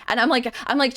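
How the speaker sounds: ordinary delivery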